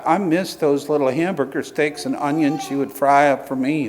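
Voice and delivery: normal talking voice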